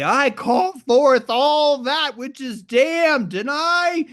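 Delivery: high, slow, booming incantation; lofty wizard invocation, drawn out and high; creative wizard. Acted. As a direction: proclaim it like a sorcerer casting a blessing. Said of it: "But I'm a creative wizard".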